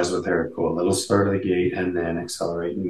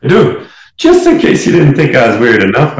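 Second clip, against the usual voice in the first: higher and louder, teasing self-mockery; playful; 7 semitones higher, 10 dB louder than usual. Real, not acted. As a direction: own the weirdness with a grin. Playfully owning being weird.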